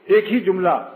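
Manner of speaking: usual speaking voice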